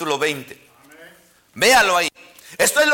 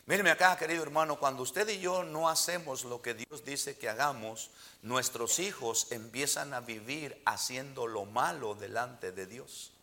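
much softer, earnest low plea; a hushed, heartfelt warning to the church; quiet appeal; 8 semitones lower, 13 dB quieter than usual. Real, not acted. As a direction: pull the congregation in close and quiet. Preacher drops to an intimate appeal.

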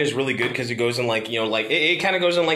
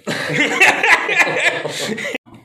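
big high laugh; 13 semitones higher, 4 dB louder than usual. Real, not acted. Laugh before the show's outro.

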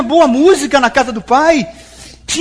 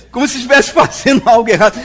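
laughing; 5 dB louder, faster than usual. Real, not acted. Laughs at the story.

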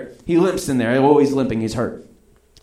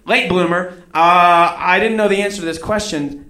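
ordinary talk, nothing marked

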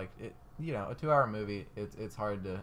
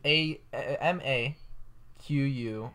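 spelling out; 2 semitones higher, 5 dB louder, slower than usual. Shown as a demonstration. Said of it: "It's A".

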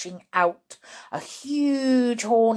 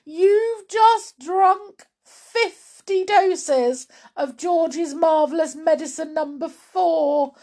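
wailing; 7 semitones higher, 5 dB louder than usual. Acted. Daughter tells Grandma what she has drunk, wailing. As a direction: stress each alarming fact. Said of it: "wailed Missus Cranky".

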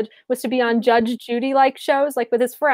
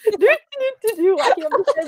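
mock spooky disbelief; 7 semitones higher than usual. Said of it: "they're like".

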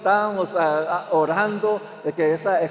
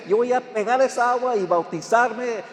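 lightly voiced eager child, higher; 6 semitones higher, 4 dB louder than usual. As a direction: mimic the excited grandson's vow. Grandson quoted, eager to be baptized. (niño entusiasta, apenas imitado y más agudo)

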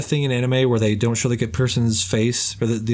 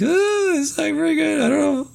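high-pitched, hesitant squeal of doubt; doubtful reaction. Retold or imitated. Skeptical retold reaction to accent.

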